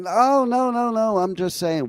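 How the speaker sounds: high panicked backpedaling. Retold or imitated. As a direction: scramble to explain, scared. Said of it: "when he gets mad".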